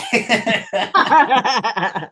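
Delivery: high shrieking laugh into a towel; real overwhelmed shriek-laugh; muffled shriek. Real, not acted. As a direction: let out a squeal muffled in cloth. Stifled scream into a towel.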